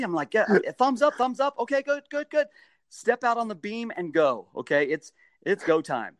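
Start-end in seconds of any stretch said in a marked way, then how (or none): 0.3-2.5 high-pitched, eager reassurance; keyed-up ready signal; hurried go-ahead. Retold or imitated. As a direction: rattle off the all-clear in a raised voice. Acting his hurried ready signal.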